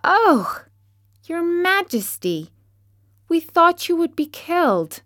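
high, breathless mouse chorus; excited exclaim. Acted. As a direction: gasp with relief. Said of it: "they exclaimed".

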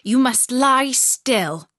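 loud, slow order; stern robber girl. A narrator's reading. Robber girl orders Gerda to lie still, loud and slow. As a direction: tell her firmly to stay put. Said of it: "said the little robber girl".

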